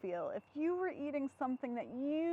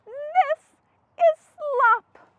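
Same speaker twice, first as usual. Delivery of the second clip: higher, proud little pig; Piggy voice; 13 semitones higher, 12 dB louder, much slower than usual. Acted. Piggy presenting her slop to Gerald, high proud pig voice. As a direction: show off the dish with pride.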